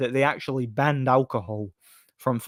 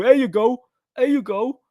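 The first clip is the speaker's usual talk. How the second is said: much higher, sing-song cornerman encouragement; 12 semitones higher, 4 dB louder than usual. Retold or imitated. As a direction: cheer each jab like a cornerman.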